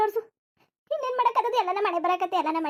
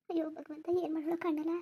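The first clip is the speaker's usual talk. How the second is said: very soft, despairing appeal to God; hushed, helpless worry; helpless lament; 9 dB quieter than usual. Acted. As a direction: lament quietly to yourself.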